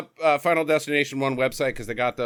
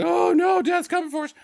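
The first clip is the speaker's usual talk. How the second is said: mock panic; 4 dB louder than usual. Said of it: "and they're like".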